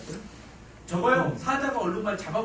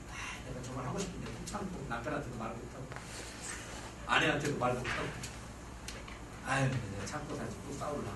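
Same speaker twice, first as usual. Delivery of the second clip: timid inner grumble; 7 semitones lower, 8 dB quieter than usual. Retold or imitated. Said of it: "이러시면".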